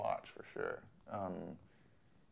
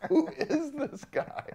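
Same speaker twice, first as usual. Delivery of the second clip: much higher, louder, slow, stunned disbelief; flipped out; 9 dB louder, slower than usual. Retold or imitated. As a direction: throw it up high in shock at the British voice. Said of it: "Jamie just flipped out".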